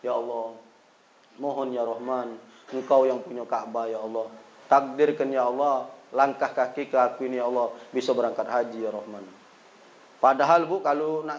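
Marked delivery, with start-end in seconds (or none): 0.1-9.4 retold prayer. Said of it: "sambil angkat tangan aku".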